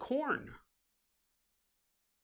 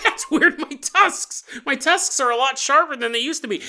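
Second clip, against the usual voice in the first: higher and louder, mock bewildered; puzzled pig person; 6 semitones higher, 4 dB louder than usual. Newly turned pig person noticing his tusks, mock puzzled. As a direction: sound baffled by your own tusks, playfully.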